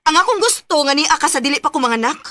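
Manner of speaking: loud, high insistent scolding; angry demand. Acted. Girlfriend scolding her boyfriend on the phone, angry and loud. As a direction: push him hard to come home now.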